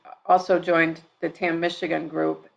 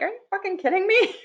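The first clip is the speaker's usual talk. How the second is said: high-pitched incredulous outburst, re-enacted; retold outrage. Mother re-enacts snapping at her addicted daughter, high-pitched outrage. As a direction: act out exasperated disbelief, voice shooting up.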